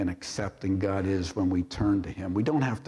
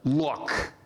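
curt, dismissive look; disrespectful look. Shown as a demonstration. Older son brushing off his father, curt and dismissive. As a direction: snap it at the father like a rebuke. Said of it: "that's the tone there of that word".

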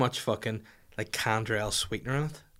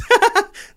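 high, loud shriek of a laugh; a real squealing laugh; burst of laughter; 11 dB louder than usual. Real, not acted. Cracking up at the Goosebumps title joke, a loud shrieking laugh.

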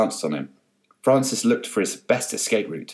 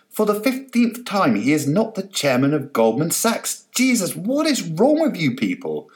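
exasperated; 9 semitones higher than usual. Acted. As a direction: spell it out in exasperation. Carol exasperated.

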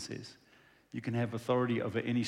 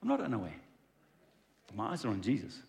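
calm confident; 4 semitones lower, 6 dB quieter, faster than usual. Retold or imitated. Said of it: "He said".

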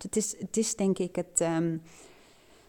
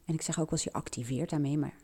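quick whispered afterthought; whispered aside; 2 semitones lower, 7 dB quieter, much faster than usual. Real, not acted. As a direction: drop to a fast whisper. Whispered aside correcting herself.

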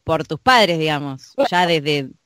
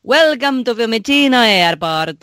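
put-on slow English with an Italian accent; 2 semitones higher, slower than usual. Host recalls an airport announcement, light Italian accent. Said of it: "el acento italiano hablando inglés".

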